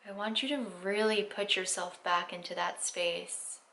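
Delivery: guided meditation. Acted. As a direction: ease them into stillness, unhurried.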